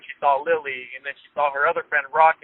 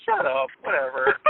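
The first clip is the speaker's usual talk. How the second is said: mock dismissive fiancée; 11 semitones higher than usual. Fiancée brushing off the surprise.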